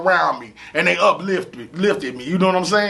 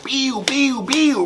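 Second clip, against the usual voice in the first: imitated gunshot sound; 5 semitones higher, much slower than usual. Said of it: "coming with him like".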